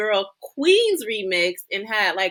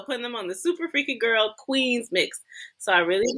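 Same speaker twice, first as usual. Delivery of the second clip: ordinary delivery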